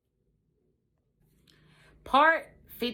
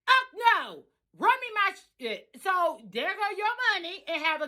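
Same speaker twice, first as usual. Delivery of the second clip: angry demand; 8 semitones higher than usual. Retold or imitated. Demanding the Joneses pay up, angry. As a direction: demand what you're owed, no patience. Demanding her money back.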